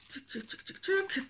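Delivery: little tchouk-tchouk sound effect; self-made busy filler noise; playful clicking noises. Real, not acted. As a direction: tchouk along softly, then pop up high. Filler noises while changing cards.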